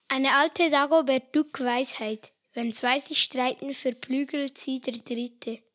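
normal talking voice

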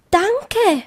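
high, falling bunny thanks; a touch of overwhelmed bunny; stammered thanks. A narrator's reading. Bunny thanking the rainbow, lightly stammered. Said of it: "stammelte Tips".

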